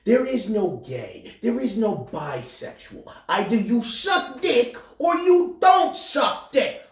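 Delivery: brash comic bit, high and loud; slow emphatic delivery building to a shouted punch; Andrew Dice Clay. Acted. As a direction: belt it out like a stand-up catchphrase. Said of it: "Andrew Dice Clay in nineteen eighty-six".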